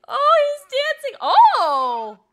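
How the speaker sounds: loud, high burst of adoration; delighted squeal. Real, not acted. Player sees the little boy dancing, loud delighted squeal. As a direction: cry out in sheer delight. Delight at character starting to dance.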